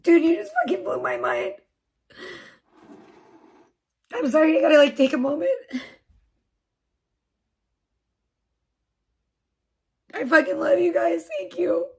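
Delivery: real tearful thanks, voice high and breaking; crying. Real, not acted. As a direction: choke up while thanking them, close to sobbing. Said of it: "you guys are gonna make me cry".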